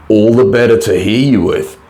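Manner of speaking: a hint of a muffled wolf voice; wolf answering from under the covers; muffled reply. A narrator's reading. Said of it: "came the muffled reply".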